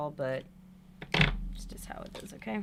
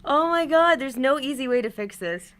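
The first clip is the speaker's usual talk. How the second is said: loud frustration; 4 semitones higher, 9 dB louder than usual. Real, not acted.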